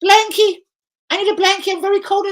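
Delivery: put-on very high babyish whine; squeaky childish plea for a blankie; whiny blankie voice.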